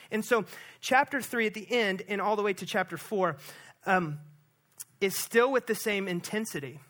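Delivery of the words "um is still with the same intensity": normal talking voice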